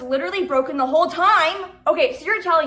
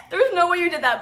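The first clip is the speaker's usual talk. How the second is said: higher-pitched, incredulous laugh; amused, can't believe it; laughing disbelief; 5 semitones higher than usual. Real, not acted. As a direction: laugh through your disbelief.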